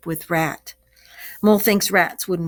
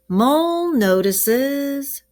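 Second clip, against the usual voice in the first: drawn out, higher sentence starter; slow model sentence; 8 semitones higher, 4 dB louder, much slower than usual. Shown as a demonstration. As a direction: model it slowly for the class to repeat.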